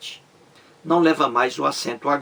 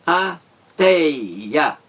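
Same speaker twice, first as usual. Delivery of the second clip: "ateia" pulled apart into a, tei, a; teacher counting the syllables of "ateia"; 2 semitones higher, 3 dB louder than usual. Shown as a demonstration. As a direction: spell out "ateia" in three slow beats. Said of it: "três sílabas".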